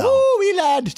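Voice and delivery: high, loosely mocked Irish lilt; hammed-up Irish "wee lad", drawn high; Irish accent. Shown as a demonstration. Host prodding [name] for an accent, mock Irish accent. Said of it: "a little something in an Irish accent".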